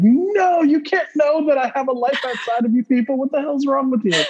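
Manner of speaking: high indignant protest; mock outrage. Worker protesting to coworkers, high mock outrage. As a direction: act scandalised at coworkers knowing.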